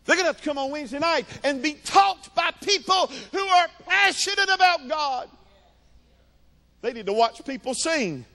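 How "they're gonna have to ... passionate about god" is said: high, fervent pulpit cry; full-force preaching. Acted. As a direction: drive it home to the congregation.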